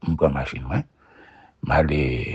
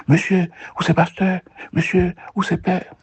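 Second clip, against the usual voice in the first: eager crowd voice. Retold or imitated. People crowding, asking for the pastor.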